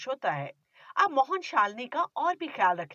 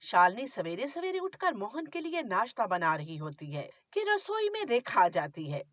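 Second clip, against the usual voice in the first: usual speaking voice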